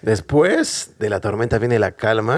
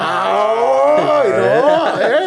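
much higher, louder ay no; mock shock; 12 semitones higher, 4 dB louder, much slower than usual. Real, not acted. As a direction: gasp it out in fake horror. Mock shock at devil child.

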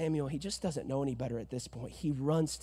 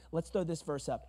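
normal talking voice

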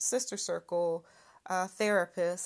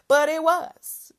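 loud, slow, high "But it was"; emphatic admission; 7 semitones higher, 12 dB louder than usual. Real, not acted. Admitting the truth to herself, loud and slow.

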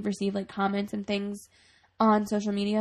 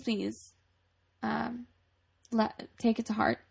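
normal talking voice

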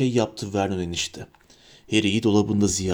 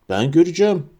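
put-on higher-pitched bossy child; commanding; 5 semitones higher, faster than usual. A narrator's reading. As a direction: lay down an order like a spoilt boy. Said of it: "diye buyurdu".

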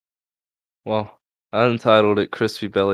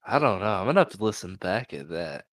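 ordinary talk, nothing marked

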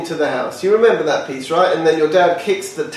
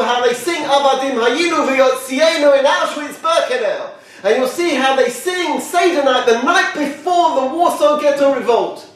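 higher, swelling proclamation; God's rising decree; 7 semitones higher than usual. Acted. God charging Eliyahu to witness the people, higher and proclaiming. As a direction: lift it into a triumphant charge.

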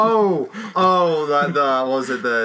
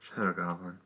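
low, very quiet imitation of the character; quoted muttered line; 9 semitones lower, 13 dB quieter than usual. Imitating the hero seeing the TV ad, low and quiet. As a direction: say it low and under your breath. Quoting film line, deadpan.

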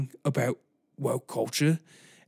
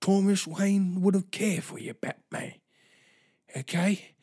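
Michael Caine; 6 semitones higher than usual. Retold or imitated. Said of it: "I'm Michael Caine".

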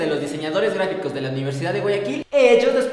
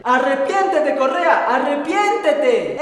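higher, slow drawn-out cry to repent; preacher shouting repent; 5 semitones higher, 4 dB louder, much slower than usual. Acted. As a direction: thunder it like a street prophet. Street preacher yelling repent.

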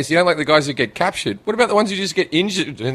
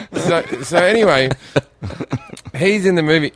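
usual speaking voice

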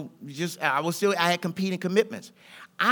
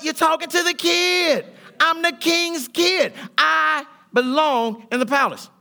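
slow, higher, louder cocky brag; swaggering boast; 7 semitones higher, 4 dB louder, slower than usual. Retold or imitated. Answering questions about life, slow cocky brag. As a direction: strut it out slowly, proud. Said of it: "So when you ask me".

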